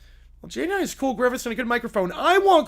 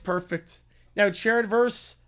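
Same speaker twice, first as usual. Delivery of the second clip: ordinary delivery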